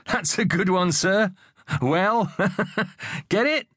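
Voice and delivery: high, chuckling delivery; giggly, pleased with the joke; laughing joke. A narrator's reading. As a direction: crack yourself up at your own pun. Joking with teacher, laughs heard.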